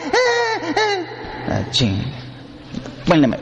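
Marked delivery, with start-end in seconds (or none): 0.1-1.1 put-on high sobbing eh, eh of a baby; mock baby sob. Preacher imitating the baby crying again, high sobbing. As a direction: wail like a fussing infant.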